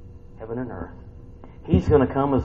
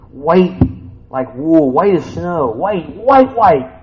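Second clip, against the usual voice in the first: awed exclamation; 5 semitones higher, 9 dB louder, much slower than usual. Real, not acted. Preacher trying to picture Jesus, excited and loud. Marveling at the image.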